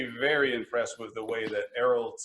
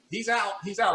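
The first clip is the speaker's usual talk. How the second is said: re-enacted screaming, voice pitched up but held back; retold excited yell; 8 semitones higher than usual. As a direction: imitate yourself yelling down the phone. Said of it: "yelling at the top of my lungs".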